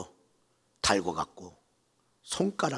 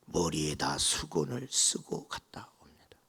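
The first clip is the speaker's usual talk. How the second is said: ordinary talk, nothing marked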